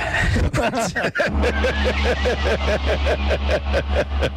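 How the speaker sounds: long high laugh at his own bold pick. Real, not acted. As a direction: burst out laughing at your own prediction. Host laughs at own prediction.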